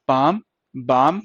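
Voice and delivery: "पाम, बाम" spoken very slowly, "आ" held; slow vowel demo. Shown as a demonstration. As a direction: drag each word out for the class.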